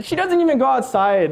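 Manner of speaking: normal talking voice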